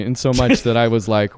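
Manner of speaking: ordinary talk, nothing marked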